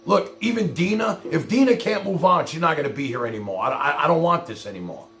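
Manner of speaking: normal talking voice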